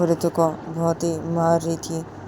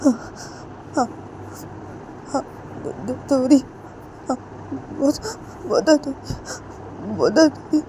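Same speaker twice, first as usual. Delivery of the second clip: moaning in pain; 8 semitones higher than usual. Acted.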